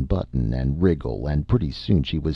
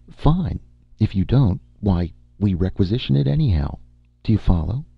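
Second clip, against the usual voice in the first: higher, confident, businesslike woman's voice; young woman bargaining hard, higher pitched with pointed emphasis; Amy, brisk and pushy; 7 semitones higher than usual. Acted. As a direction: lay down terms sweetly but firmly. Amy presses her offer.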